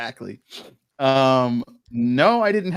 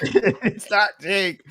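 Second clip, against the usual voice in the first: slow laughing repeat; 7 semitones higher, much slower than usual. Acted.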